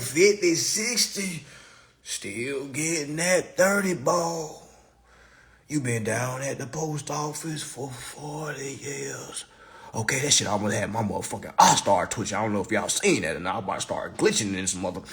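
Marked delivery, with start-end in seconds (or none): 5.7-9.4 slow, pitying whisper. Acted. Comic to a forty-year postal worker, slow whisper. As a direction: hush it like a sad secret. Pitying aside about long job.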